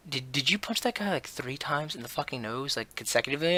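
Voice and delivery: hushed, incredulous question from a friend; disbelieving. Retold or imitated. Senior friends ask about the three punches, disbelieving.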